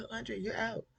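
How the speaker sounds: high, mocking singsong with a laugh; smug dismissal repeated. Host mocking Gideon's smug line, high and laughing. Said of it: "Punk ass smiling".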